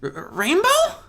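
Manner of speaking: high, stammering, worried filly voice; a small filly unsure what's wrong. Acted. Scoot asks her idol worriedly, stammering. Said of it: "Scoot asked worriedly".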